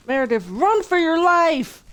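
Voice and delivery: loud, high cry to run; drawn-out, playful panicked yell to flee; urgent warning. Pretend. Urging game character to flee.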